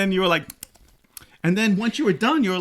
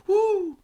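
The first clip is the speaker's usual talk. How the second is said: sour pucker woo. Retold or imitated. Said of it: "would be like".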